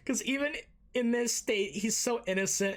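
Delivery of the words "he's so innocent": usual speaking voice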